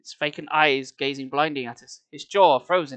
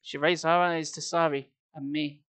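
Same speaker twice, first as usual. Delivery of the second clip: ordinary delivery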